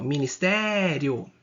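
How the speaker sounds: "ministério" drawn out slowly, rio kept as one syllable; final syllable joined. Shown as a demonstration. Teacher showing that the ending stays together, "ministério" slow with joined rio. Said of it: "ele é juntinho".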